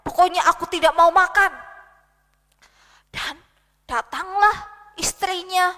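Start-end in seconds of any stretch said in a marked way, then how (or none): 0.1-1.5 stubborn angry king grumbling loudly; grumbling refusal. Acted. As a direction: dig in like a sulking child-king. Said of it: "dia masih ngomel-ngomel".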